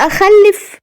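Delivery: high, pained questioning echo; sad. A narrator's reading. Wife's smile fades at thought of childbirth, sad. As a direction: let joy drop into a high, sad question. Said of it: "وهي بتقول بحزن".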